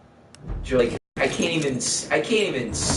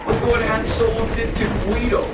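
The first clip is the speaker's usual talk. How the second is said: worked-up rant; 11 semitones higher than usual. Real, not acted. Ranting about Han Solo.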